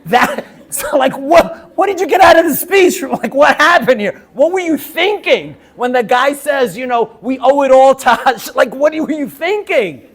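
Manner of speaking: loud, high, laughing astonishment; laughing disbelief. Retold or imitated. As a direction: burst out in laughing disbelief at the question. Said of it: "I'm like".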